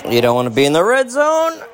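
high, loud shout; game-day shout. Real, not acted. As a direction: yell it at the TV. Yelling during a tense play.

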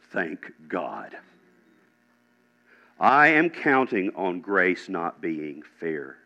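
low, hushed, slow preacher's reflection; solemn preaching. Real, not acted. Preacher on grace not being fair, solemn and hushed. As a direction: let it sink in, soft and low.

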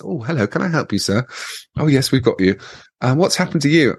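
imitated hospital receptionist. A and E woman greeting a wrecked patient, politely concerned. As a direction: lift the pitch into a customer-service greeting. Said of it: "the woman looked at me and goes".

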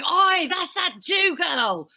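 very high, slow brash shout. Retold or imitated. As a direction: call out like a brash London party girl.

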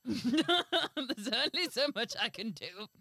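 loud, slowed words through laughter; laughing. Real, not acted. Refusing co-host another accent, laughing out loud. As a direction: protest while cracking up.

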